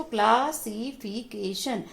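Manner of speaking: "Classification" slowly, in five even beats; matter-of-fact chunked reading of "classification"; syllables read separately. Shown as a demonstration. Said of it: "अलग-अलग कर दिया इसको".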